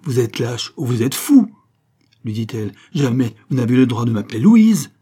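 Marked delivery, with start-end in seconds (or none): 0.0-1.5 loud, outraged accusation; indignant fury. A narrator's reading. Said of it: "indigné, hors d'elle-même". 2.9-4.9 loud, forceful rebuke; indignant fury. A narrator's reading. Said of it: "indigné, hors d'elle-même".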